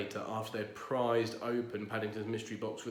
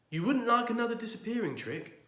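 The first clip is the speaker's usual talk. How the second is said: hopeful; 11 semitones higher, 5 dB louder than usual. Acted. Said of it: "asked Paddington hopefully".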